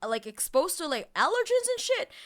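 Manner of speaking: mock alarm at the rash. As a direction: retell the freak-out climbing up. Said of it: "and I'm like".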